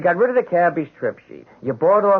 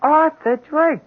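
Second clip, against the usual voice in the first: startled realization; 13 semitones higher, 4 dB louder, slower than usual. Acted. Sudden realization of name.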